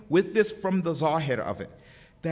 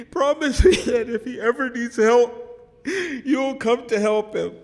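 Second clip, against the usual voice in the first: raised voice broken by sobs; tearful plea; 4 semitones higher, 5 dB louder than usual. Real, not acted. Preacher voicing the Prophet's plea to help Hussein, tearful. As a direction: plead loudly while crying.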